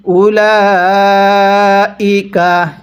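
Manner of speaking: slow, drawn-out tajweed chanting; slow Quran recitation. Shown as a demonstration. Teacher demonstrating recitation to students, slow chant. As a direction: recite each syllable long and measured. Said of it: "তাহলে".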